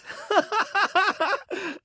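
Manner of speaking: high-pitched, delighted giggling; joyful laugh. Acted. Reunited with long-lost Stitch, joyful laughter. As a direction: laugh in a high burst of relief.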